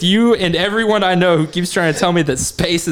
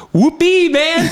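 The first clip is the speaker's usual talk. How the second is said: much higher, louder, laughing mock astronaut; gleeful whoop; 14 semitones higher, 4 dB louder, slower than usual.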